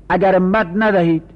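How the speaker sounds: ordinary delivery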